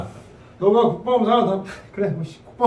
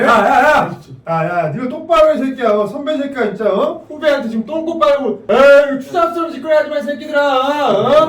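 raised, high-pitched angry rant; angry scolding; 4 semitones higher, 4 dB louder than usual. Acted. Senior berating friends for sucking up to a junior, angry scolding. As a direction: lay into them, getting louder.

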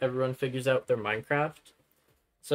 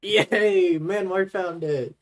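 excited cheer; 4 semitones higher, 7 dB louder, much slower than usual. Real, not acted. Cheering as friend finds item.

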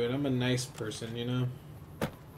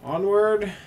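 higher and louder, a hammed-up rallying cry; mock heroic call; 8 semitones higher, 8 dB louder than usual. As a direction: call the march like a pretend knight.

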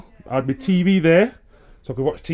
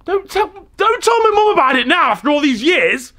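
panicked pleading; 4 dB louder than usual. Real, not acted. Begging daughter not to tell.

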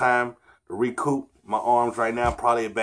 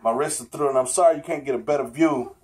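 usual speaking voice

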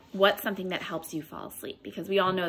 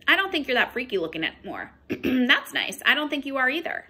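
lively storybook read-aloud with character voices; picture-book storytime voice; reading to children; 4 semitones higher than usual. Acted. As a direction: read it to a class of little ones. Teacher reads picture book aloud.

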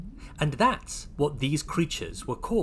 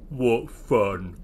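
Big; 2 semitones lower, 3 dB louder than usual. Acted. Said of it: "inked Big".

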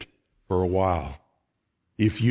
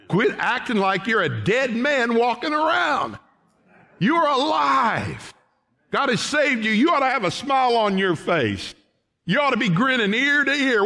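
high, shouted exhortation; preacher shouting joy; full-force preaching; 14 semitones higher than usual. Acted. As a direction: fire up the congregation.

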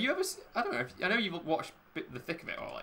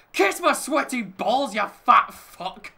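higher, much louder, slow and spat out; furious retort; 9 semitones higher, 11 dB louder, slower than usual. Retold or imitated. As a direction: scream the insult slowly. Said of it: "Malcolm's response".

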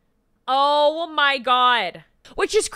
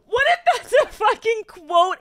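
laughing; 10 semitones higher, 5 dB louder than usual. Real, not acted. Laughing hysterically at quote.

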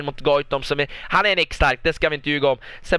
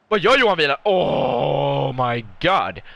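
shocked disbelief; slower than usual. Real, not acted. Commentator reacts to keeper Wieland's blunder, aghast and drawn-out. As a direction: stretch the exclamation out in disbelief.

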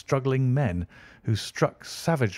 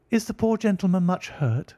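high, concerned lady's voice; a worried lady calls down the steps; Irene asking; 9 semitones higher than usual. A narrator's reading. Irene Adler calls from the steps, concerned for the fallen man. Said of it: "she asked".

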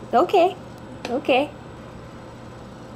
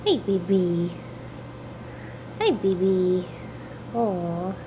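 deep pet talk; 9 semitones lower, 5 dB quieter than usual. Acted. Cooing at grumpy cat.

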